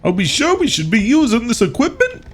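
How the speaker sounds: high, nasal-free squeaky Luigi calling out. Pretend. Gamer voicing Luigi to his buddy Mario, high and worried. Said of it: "don't worry about it, Luigi".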